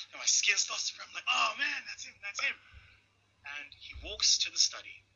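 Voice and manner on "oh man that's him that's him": high-pitched flustered reaction; acted-out nervous fluster; mock panic. Acts out panic at Mandela arriving.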